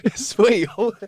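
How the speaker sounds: high, giggly confusion; real laughter while admitting confusion; laughing. Real, not acted. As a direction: laugh through the words, pitched high. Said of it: "I'm laughing, I don't even get it".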